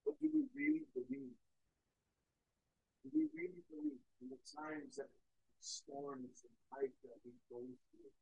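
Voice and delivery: very soft, searching questions; hushed challenge. Real, not acted. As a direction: drop right down and ask the congregation earnestly.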